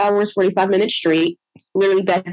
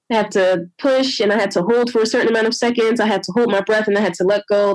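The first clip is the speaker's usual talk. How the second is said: normal talking voice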